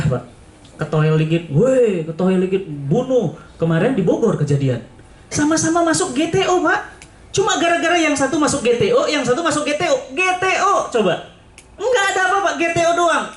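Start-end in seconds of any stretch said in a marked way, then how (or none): 5.3-13.2 high, incredulous storytelling; high-pitched disbelief; animated outrage. Retold or imitated. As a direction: stress the absurdity of the toll-gate fight. Animated retelling of toll quarrel.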